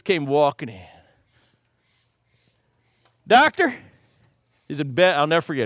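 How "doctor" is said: high Texas-drawl call for the doctor; Texan patient. Retold or imitated. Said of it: "He was from Texas".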